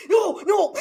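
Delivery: high, loud reenacted shout; mock-horrified yelling; panicked refusal. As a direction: shout it while backing away in alarm. Retelling fleeing the costumed character.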